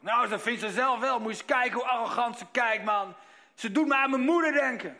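put-on high, loud, indignant old lady voice; scornful old woman. Demented woman mocking her mirror image, indignant. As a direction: sneer at the arrogant face.

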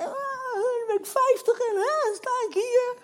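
high, loud sobbing words, voice breaking; crying. Retold or imitated. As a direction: cry it out as you say it. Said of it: "Ik begin echt te janken".

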